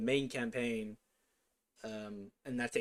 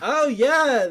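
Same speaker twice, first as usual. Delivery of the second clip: excited; 13 semitones higher, 17 dB louder than usual. Real, not acted. Realizes the joke, loud.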